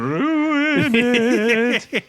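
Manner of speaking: milk the title like a spooky game show host; dramatic announcer. Acted. Repeating the game's title dramatically.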